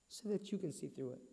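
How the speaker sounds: very soft, low, falling away; hushed low confession of a sacrifice; hushed, moved. Acted. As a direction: let it sink almost to nothing, low and tender. Mother's sacrifice told in her words.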